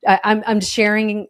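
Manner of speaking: ordinary delivery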